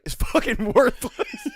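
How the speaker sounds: high, loud, slow, laughing mock outrage; mock furious. Retelling the breakfast rant to a cohost, laughing mock anger. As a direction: imitate an angry friend while cracking up. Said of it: "he was furious".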